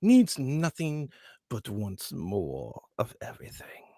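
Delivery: greedy noble voice, fading to a whisper; noble villain voice. Shown as a demonstration. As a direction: savor the greed, end in a whisper.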